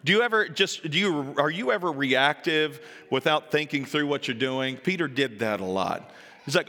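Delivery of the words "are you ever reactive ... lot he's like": normal talking voice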